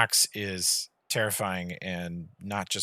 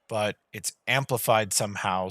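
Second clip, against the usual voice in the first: usual speaking voice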